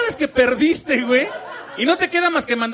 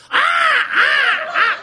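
much higher, louder mock battle yell; mock war cry; 12 semitones higher, 7 dB louder, much slower than usual. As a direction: charge screaming with a tire iron, hammed up. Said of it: "con su pinche llave de cruz así de". (grito de guerra exagerado)

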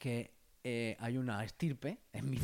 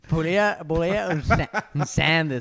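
mock customer; 2 semitones higher, 8 dB louder than usual. Role-play ordering at McDonald's.

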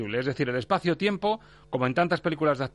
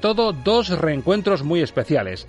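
radio announcer; 3 semitones higher, 6 dB louder than usual. Acted. Radio show opening presentation.

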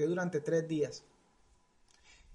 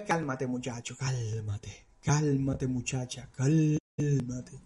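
calming hush; 6 semitones lower, slower than usual. Acted. Preacher soothes teenagers.

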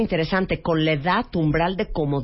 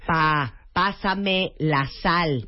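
very slow, each word spelled out; slow and clear; 3 semitones lower, much slower than usual. Shown as a demonstration. Asking elderly dad for the salt, slowly and clearly. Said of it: "A ver, un ejemplo".

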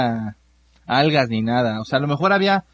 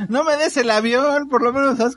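laughing; 10 semitones higher than usual. Real, not acted. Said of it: "laughing reaction".

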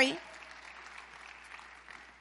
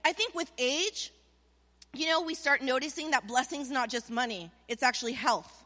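ordinary talk

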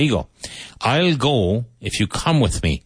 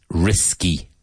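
ordinary talk, nothing marked

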